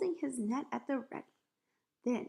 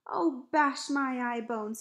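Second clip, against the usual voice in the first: slow, loud oath; distressed cry; 3 semitones higher, 7 dB louder, slower than usual. A narrator's reading. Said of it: "getting more distressed every moment".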